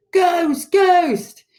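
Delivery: loud, very high cries of alarm; crowd's frightened shout; panicked yelling. A narrator's reading. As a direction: yell it out in a panic. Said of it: "with everybody yelling".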